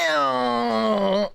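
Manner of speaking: long, high drawn-out ew; mock disgust. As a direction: imitate grossed-out viewers, stretching it out. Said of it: "and you guys go".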